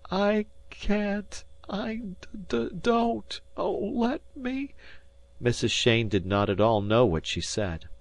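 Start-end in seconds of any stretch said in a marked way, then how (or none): 0.1-4.7 much higher, breaking, halting; distraught sobbing voice. A narrator's reading. Grieving mother at the memorial, breaking down distraught. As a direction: voice climbing in helpless panic.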